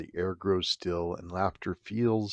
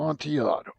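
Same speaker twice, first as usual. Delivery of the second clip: lightly voiced tipsy Fortunato; 7 semitones higher, faster than usual. Fortunato repeats name.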